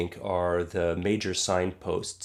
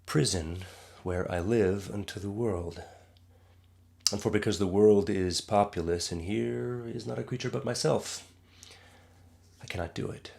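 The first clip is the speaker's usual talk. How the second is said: Shakespearean recitation. Acted. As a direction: recite it as a pensive monologue, sinking to a hush. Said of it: "this speech that I was just reciting".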